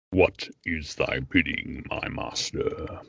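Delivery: Darth Vader. Acted. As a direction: intone it slowly and darkly, like a servant to a master. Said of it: "I know you do Vader very well".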